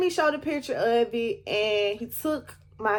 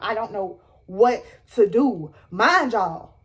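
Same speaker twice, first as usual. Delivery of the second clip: ordinary delivery, nothing marked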